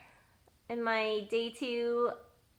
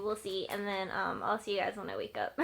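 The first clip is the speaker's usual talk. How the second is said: usual speaking voice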